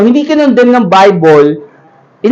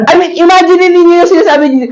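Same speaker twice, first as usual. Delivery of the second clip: laughing; 8 semitones higher than usual. Real, not acted.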